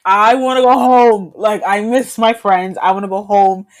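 mock the homesick kid begging; pleading to go home. Retelling crying in French class.

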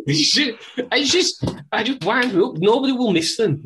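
loud, high-pitched mock rant. Ranting in character, high pitched.

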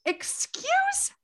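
high, slow, scandalized protest; exasperated. Retold or imitated. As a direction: clutch your pearls, drawn out. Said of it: "everybody in Congress is like".

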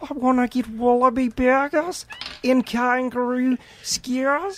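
high, bad Aussie accent; a goofy Australian voice. Role-play as Australian Finney.

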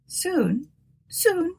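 high, soft soothing voice; pacifying. A narrator's reading. Martha trying to pacify hysterical Tabitha, soothing. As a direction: soothe a frightened friend, gently. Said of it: "trying to pacify her".

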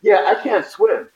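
high and slow, a little embarrassed; sheepish admission. Retold or imitated. Actor admitting to the director, slow and sheepish. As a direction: confess it slowly, almost apologetic. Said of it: "I was like".

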